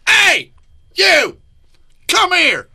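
very high, loud retold hail; summoning shout. Retelling a star calling him over, summoning shout. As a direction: imitate a star yelling someone over.

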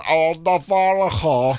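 slow put-on voice; mock impression of her voice; imitating her speech. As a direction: talk the way she does, slowly. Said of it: "She talks like this".

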